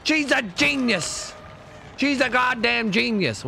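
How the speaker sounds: high, slow amazed exclamations. Real, not acted. Gamer marvels at the survivor's vault, high and drawn out. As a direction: gasp and gush over her play.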